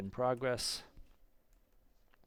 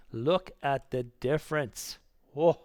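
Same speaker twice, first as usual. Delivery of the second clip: loud, drawn-out excited amazement; too excited; 4 semitones higher, 9 dB louder than usual. Real, not acted. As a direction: marvel at it, slowly and loudly. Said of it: "I'm getting too excited here".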